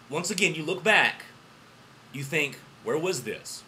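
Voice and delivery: normal talking voice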